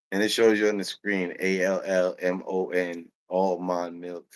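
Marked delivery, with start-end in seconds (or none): none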